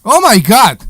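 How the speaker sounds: loud, high exasperated cry; exasperated shout. Real, not acted. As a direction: blurt it out in disbelief. Exasperated outburst at interruption.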